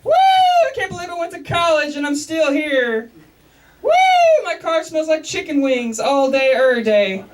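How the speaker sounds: mock-cheerful shouting from the car; yelling out car window. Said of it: "I used to yell the same thing".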